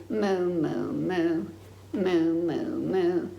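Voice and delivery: low falling moos; mock cow mooing. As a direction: moo like a lazy cow. Cow noises in game.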